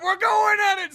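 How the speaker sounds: high shouted dad; shouting dad. Acted. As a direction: scream it while flooring it. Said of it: "He's like".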